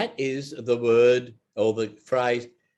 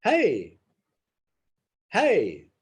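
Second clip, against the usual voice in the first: casual hey; 3 semitones higher, 4 dB louder, much slower than usual. Shown as a demonstration. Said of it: "you just say".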